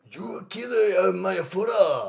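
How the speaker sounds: exaggerated mock Japanese English; hammy fake Japanese accent; terrible Japanese accent impression. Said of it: "it's a terrible English, Japanese impression dub".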